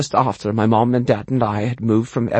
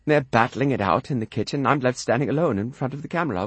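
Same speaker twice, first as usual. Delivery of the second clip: usual speaking voice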